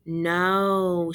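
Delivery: plain reading voice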